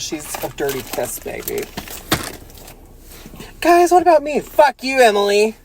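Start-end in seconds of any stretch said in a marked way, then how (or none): 3.6-5.6 high, loud complaining figure; whiny puppet voice. Acted. A left-out candy figure yelling at Emily. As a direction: pipe up indignantly, then snap.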